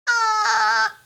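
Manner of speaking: high crying ah; George says ah. Acted. George opens his mouth for the doctor, high wailing ah. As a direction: open wide with a little wail. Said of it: "says George".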